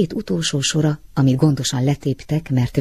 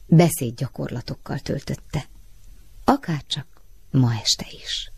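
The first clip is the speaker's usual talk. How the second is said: ordinary delivery, nothing marked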